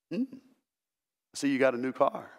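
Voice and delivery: low, restrained mock-dignified voice. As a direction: play a reserved, dignified churchgoer, pitched well down.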